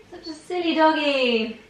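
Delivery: high sing-song pet voice; baby talk to dog. Acted. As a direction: coo it to the dog.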